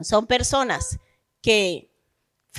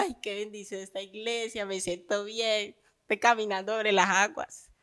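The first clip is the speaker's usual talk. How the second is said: mock blissful believer; 3 semitones lower, 8 dB quieter than usual. Mimics a contented new believer.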